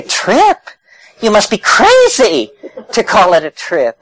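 offended, incredulous outburst; indignant. Retold or imitated. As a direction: scoff at the very word. Tripper indignant at word trip.

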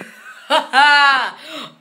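loud, high burst of laughter; excited laugh. Real, not acted. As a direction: laugh out loud at the big move. Laughs after the big move.

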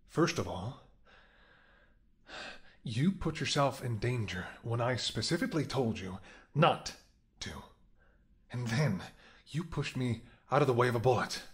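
hurt and angry lecture; upset scolding. Acted. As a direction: tell him off for scaring you. Scolding partner who risked himself.

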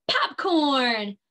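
ordinary talk

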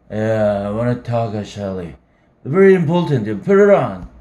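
put-on drunk. Drunk Holden demanding.

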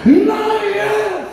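high, drawn-out preacher's cry; proclaimed cry. Acted. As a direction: call it out long and high. Preacher cries out Jesus's words. (clamor agudo y prolongado)

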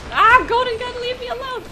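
high-pitched panicked shriek; panicked yelp. Real, not acted. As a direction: squeal it out, cornered and scared. Panicked yelp at enemy player.

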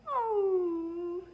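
high whimpering dog sigh; puppy whimper. A narrator's reading. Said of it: "whimpering softly".

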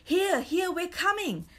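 put-on children hailing from a way off, storyteller's shout; excited shout. A narrator's reading. Lost children answer their friend, shouting. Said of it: "shouted the two children".

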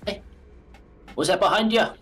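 loud, high Irish lilt; a spooky Irish voice; Irish accent. Acted. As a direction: call out playfully in an Irish accent. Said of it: "I don't know why I went Irish for that".

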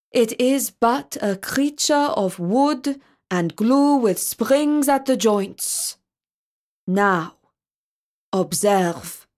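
slow, deliberate, lightly voiced rabbi; rabbi demonstrating. A narrator's reading. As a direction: explain it step by step to a king. Rabbi demonstrates creature to king.

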